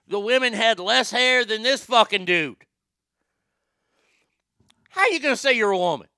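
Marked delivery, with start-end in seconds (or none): 4.9-6.1 fast, high, loud challenge; indignant, run-together question; incredulous. Real, not acted. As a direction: fire the question out in one rush. Incredulous rant, casual first take.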